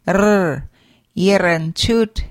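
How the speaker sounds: "yiranchut" with a rolled r. Shown as a demonstration. Teacher modelling the rolled R for learners, trilled r. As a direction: roll the r in "yiranchut". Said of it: "R is rolled on your tongue".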